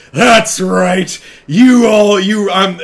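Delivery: loud, high battle cry; high gloating shout; triumphant yell. Retold or imitated. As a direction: shout it like a hunter closing in. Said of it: "Laura yells out something along the lines of".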